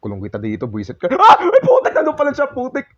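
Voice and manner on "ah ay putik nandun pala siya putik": very high, loud frightened cursing; shrieking in fright; startled panic. Real, not acted. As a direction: cry out as it suddenly appears. Jumpscare, monster appears.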